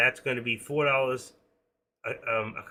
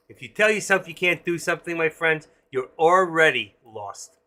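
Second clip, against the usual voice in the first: rising louder and higher, then slowing on the last words; rousing appeal; 4 semitones higher, 9 dB louder than usual. Real, not acted. Telling viewers my friends, a rousing, emphatic appeal. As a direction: drive the point home to the audience. Preaching self-belief to viewers.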